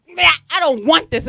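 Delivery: put-on baby refusing the breast. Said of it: "she was like".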